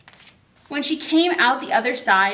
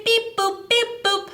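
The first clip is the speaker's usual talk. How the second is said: high chirpy alien beep boop; 10 semitones higher, slower than usual. Acted. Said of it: "let's pretend to be the aliens".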